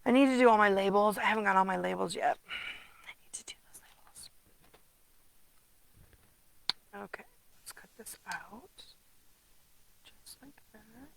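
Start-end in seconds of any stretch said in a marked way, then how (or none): 2.5-11.1 whisper. Real, not acted. Quiet asides while cutting.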